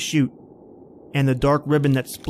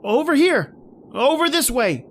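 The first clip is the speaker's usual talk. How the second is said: shouting through a blizzard; 11 semitones higher than usual. Acted. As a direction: yell to draw him away.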